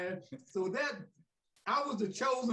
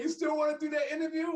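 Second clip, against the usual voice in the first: fast eager Ali; 8 semitones higher, faster than usual. Retold or imitated. Ali on phone.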